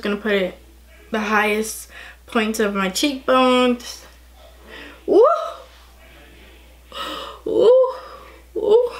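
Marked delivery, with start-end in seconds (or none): 5.1-8.9 hyped-up whooping; excited woo. Real, not acted. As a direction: cheer like at a party. Excited by highlighter.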